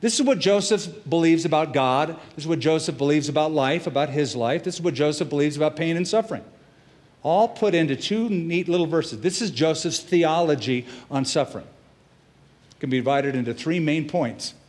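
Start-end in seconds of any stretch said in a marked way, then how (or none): none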